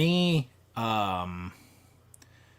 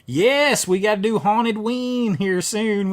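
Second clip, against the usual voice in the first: ordinary delivery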